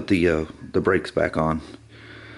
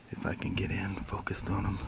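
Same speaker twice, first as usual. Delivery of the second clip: whisper; 5 semitones lower, 19 dB quieter than usual. Real, not acted. Filming flowers up close, hushed.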